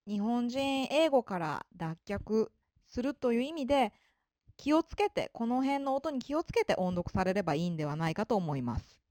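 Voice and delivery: ordinary talk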